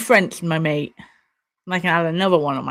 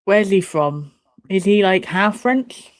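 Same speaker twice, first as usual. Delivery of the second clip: usual speaking voice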